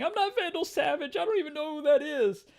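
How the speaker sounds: very high, panicked squealing; screaming Paul. Acted. Paul screaming as the fight starts, high and panicked. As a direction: squeal the denial in a hammed-up scream. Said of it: "then Paul is screaming".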